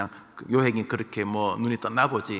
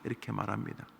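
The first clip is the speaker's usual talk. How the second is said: ordinary talk, nothing marked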